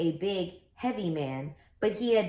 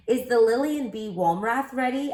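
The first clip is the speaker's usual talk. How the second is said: referee asking; 5 semitones higher, 5 dB louder than usual. Acted. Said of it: "asked the referee".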